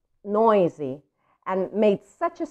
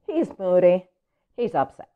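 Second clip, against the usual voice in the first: ordinary delivery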